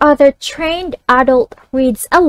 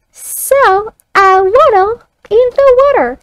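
put-on high child voice echoing back; 8 semitones higher, 4 dB louder than usual. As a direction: echo it like a young pupil. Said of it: "the child reads it back".

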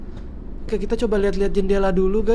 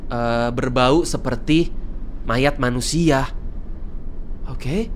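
low, hushed lore reading; spooky story narration; 8 semitones lower, 4 dB quieter than usual. Acted. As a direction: read the legend like a campfire tale. Reads creepy lore. (narasi dongeng seram, rendah dan pelan)

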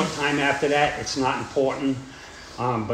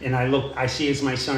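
ordinary delivery

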